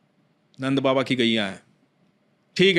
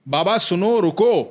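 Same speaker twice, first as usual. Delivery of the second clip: higher, louder call of बाबा सुनो; boy calls out a little louder to stop the angry devotee; 5 semitones higher, 4 dB louder, slower than usual. Retold or imitated. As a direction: raise your voice, make सुनो carry. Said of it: "बच्चे ने थोड़ा तेज आवाज दी".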